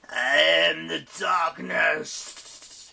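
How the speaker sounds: loud, very drawn-out hiss; hissing demon. A narrator's reading. One demon claiming to be the darkness, loudly hissing. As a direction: hiss it high and long like a demon. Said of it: "hissed one of the demons".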